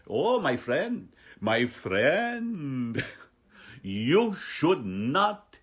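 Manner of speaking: loud, lilting bad Indian accent; terrible Indian accent. Comedian playing his vet, terrible mock Indian accent. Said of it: "I'm just terrible at it".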